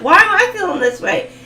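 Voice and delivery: high, loud teasing rant; mock exasperation. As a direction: scold them like a frustrated friend. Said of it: "you're gonna be like".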